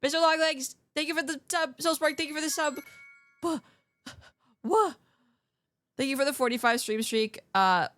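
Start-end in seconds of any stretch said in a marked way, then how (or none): 3.4-4.9 surprised. Real, not acted. Reacting to subs.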